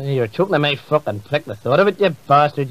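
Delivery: usual speaking voice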